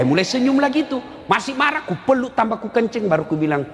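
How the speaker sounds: ordinary talk, nothing marked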